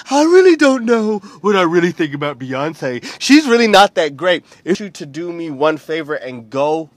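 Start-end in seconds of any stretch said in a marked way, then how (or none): 0.1-4.4 mocking a doubter. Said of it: "half of the people around here are like".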